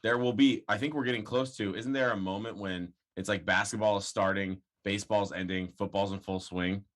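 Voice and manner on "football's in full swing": ordinary talk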